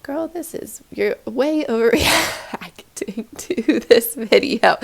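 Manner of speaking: amused imitation of a skeptical viewer; laughing mock reproach. Imagined viewer tells the host she is overreacting, laughingly. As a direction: break into laughter while voicing the objection.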